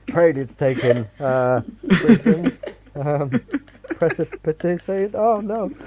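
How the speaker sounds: high, loud, laughing mock despair; hammed-up panic. Playing a writer whose titles are all taken, laughing. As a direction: squeal each word as an idea dies.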